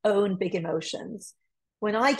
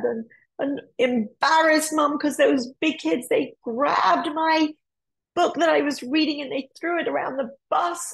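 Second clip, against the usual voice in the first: put-on upset little boy; 6 semitones higher than usual. Bullied son tells mum.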